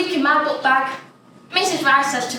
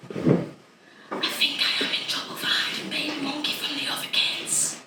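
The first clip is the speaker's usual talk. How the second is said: whisper; 4 semitones higher, 7 dB quieter than usual. Acted. Fear of being in trouble.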